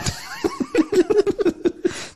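long, high, falling laugh; real laugh at his own punchline. Real, not acted. After mocking the beating with his cohost, high laugh.

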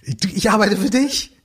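deutlich höher, aufrichtig ratlos; innerer Beamter, aufrichtig. Retold or imitated. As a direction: den Beamten mit dem Aktenkoffer höher sprechen lassen. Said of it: "so aus tiefstem Herzen sagt". (Beamter schaut vom Aktenkoffer hoch, aufrichtig und höher)

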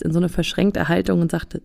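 ordinary delivery

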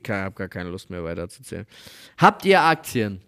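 normal talking voice

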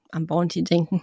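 ordinary talk, nothing marked

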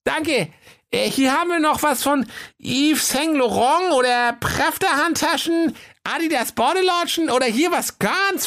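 very high, cajoling salesman voice; eager shopkeeper. Acted. Höke pitches designer wares to Kaiser, high and gushing. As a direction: talk up luxury goods in a high comic voice.

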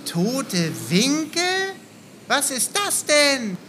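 langsam und ungläubig gedehnt; verwundert gedehnt. Acted. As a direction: zieh die Frage staunend in die Länge. Bruno puzzled, drawn out. (langsam gedehnte, verwunderte Frage)

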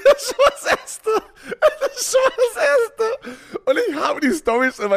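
laughing so hard the voice cracks high; squeaky laughing through the words. Real, not acted. Laughing hard while telling.

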